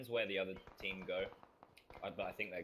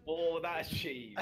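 surprised yelp; 11 semitones higher, 8 dB louder, much slower than usual. Real, not acted. Surprise at enemy play.

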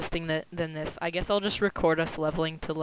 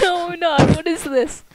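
high indignant protest; 11 semitones higher, 6 dB louder than usual. Real, not acted.

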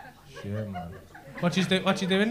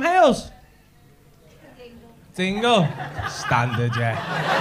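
ordinary delivery

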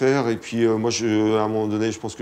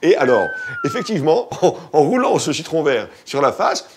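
infomercial; 8 semitones higher, 4 dB louder than usual. Pretend. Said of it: "présentateur de téléachat".

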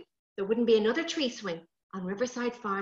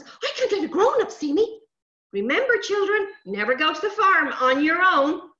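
high, loud little creature voice; urgent sprite; 6 semitones higher, 6 dB louder than usual. Acted. Sprite warning children before hiding, high and urgent.

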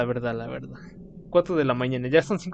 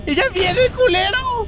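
shrill, much higher angry yell; high-pitched shout; 5 dB louder than usual. Acted. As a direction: scream at the incoming enemy. Enemy arrives again.

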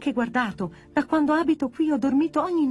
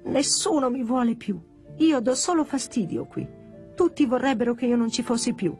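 put-on higher-pitched, plaintive child-like lament; forlorn; 6 semitones higher than usual. Pino alone while others sleep, forlorn. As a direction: pour out feeling unwanted. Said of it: "Si sentì abbandonato da tutti".

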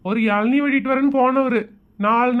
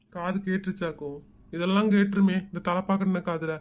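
much softer, lower worried mutter; hushed aside; 7 semitones lower, 7 dB quieter than usual. Real, not acted. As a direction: say it under your breath so he won't hear. Worried aside, fearing being overheard.

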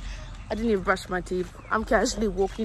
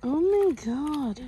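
surprised; 6 semitones higher, slower than usual. Real, not acted. Reacting to something shocking.

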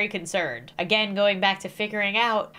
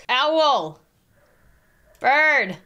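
ordinary talk, nothing marked

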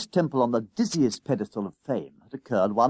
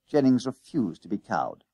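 ordinary delivery

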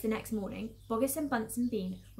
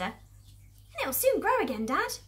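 put-on high, drawn-out child voice; high-pitched youngster talking to Dad; small fox child; 9 semitones higher, 4 dB louder, slower than usual. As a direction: put on a little fox cub's voice. Small fox speaks to father.

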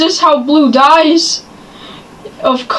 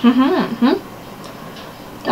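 usual speaking voice

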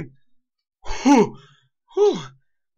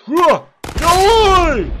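excited shout; 10 semitones higher, 9 dB louder, much slower than usual. Real, not acted. Cheering on ragdoll.

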